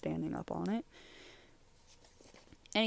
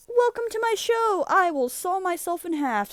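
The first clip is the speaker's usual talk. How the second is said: showman announcer; 12 semitones higher, 6 dB louder than usual. Acted. Character Magic Man's show intro.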